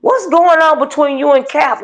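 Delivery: ordinary talk, nothing marked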